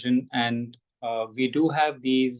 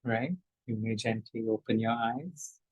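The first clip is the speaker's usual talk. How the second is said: guided breathing; 7 dB quieter than usual. Shown as a demonstration. Teacher guiding the group's breath, softly. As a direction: gently lead the breath. Breathing guidance, ending meditation.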